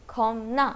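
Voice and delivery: "खौम ना" with m before the n; teacher's repeat of "खौम ना", nasal at the join; p changed to m. Shown as a demonstration. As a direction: say "खौम ना", closing the first syllable on m. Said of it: "this word will be pronounced as".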